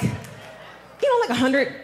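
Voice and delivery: breathy, laughing half-whisper; shy, giggly suggestion to her husband; sheepish laughing. Retold or imitated. Sheepishly proposing a hundred dollars.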